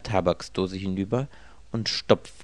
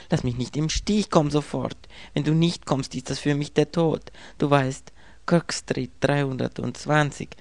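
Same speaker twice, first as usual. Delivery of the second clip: higher, pressing frightened woman; frightened woman begging her lover to come; urgent pleading; 7 semitones higher than usual. A narrator's reading. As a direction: beg him to come at once.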